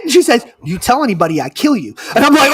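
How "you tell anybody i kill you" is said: low threat. Retold or imitated. Retold threat to keep secret.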